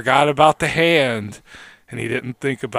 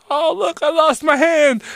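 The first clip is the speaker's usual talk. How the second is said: mock victim voice; 13 semitones higher than usual. Joking host voices the murdered woman, high and silly. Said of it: "She was like".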